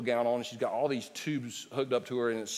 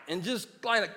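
ordinary delivery

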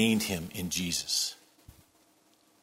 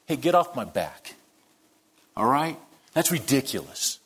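snippy comeback; 4 semitones higher than usual. Retold or imitated. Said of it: "look at James and say".

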